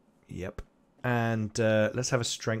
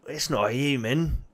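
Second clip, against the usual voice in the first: big goblin voice; 4 semitones higher than usual. Acted. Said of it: "and he's like".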